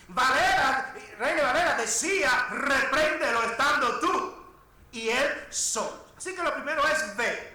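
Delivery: loud, emphatic pulpit delivery; full-force preaching. Acted. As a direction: hammer each phrase out to the congregation. (predicación a plena voz)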